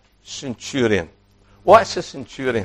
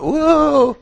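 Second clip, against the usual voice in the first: reverent whoa; 12 semitones higher than usual. Acted. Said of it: "If you can reverently say that".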